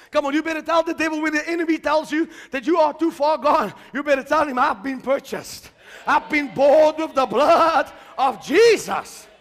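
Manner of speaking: loud, rising sermon climax; full-force preaching. Acted. Preacher declaring victory to the congregation, full-force preaching.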